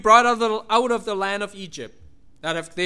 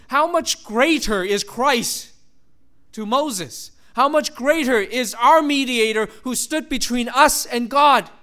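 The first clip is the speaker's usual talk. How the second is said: slow, loud, drawn-out preaching; slow emphatic preaching; 3 semitones higher, 5 dB louder, slower than usual. Real, not acted. Preacher exalts Christ the mediator, slow and loud. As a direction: lean on every phrase.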